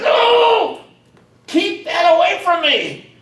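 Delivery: high, loud, frightened cry, then slow; frightened shout. Retold or imitated. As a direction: yell in alarm, keep the cursed thing away. Shaman recoils from the staff.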